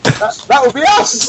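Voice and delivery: loud, high cheer. Real, not acted. Hosts answering the announcer's intro, cheering. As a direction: whoop at your own introduction.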